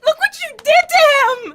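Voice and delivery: high, loud outraged yell; furious accusing shout. Acted. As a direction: scream the blame at her. Girlfriend screaming after the fight.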